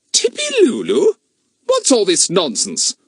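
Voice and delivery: rising, disbelieving question; incredulous. A narrator's reading. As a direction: scoff at a silly name. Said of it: "said Missus Trotbill".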